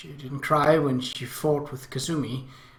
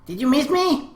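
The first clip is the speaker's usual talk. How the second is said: high, louder little-sister voice; eager little sister; 11 semitones higher, 5 dB louder than usual. A narrator's reading. Little one waiting by the exit for her brother, eager. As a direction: ask it brightly, pitched way up.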